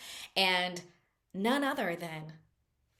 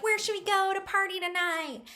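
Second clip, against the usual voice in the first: high, eager question; mock party girls; 11 semitones higher than usual. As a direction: imitate excited girls asking the waiter. Acting girls asking the waiter.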